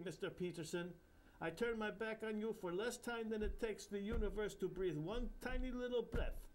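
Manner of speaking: put-on high, sing-song Indian accent; jovial Indian guru. Bapucharya teasing the narrator, Indian accent. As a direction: joke like a cheeky holy man.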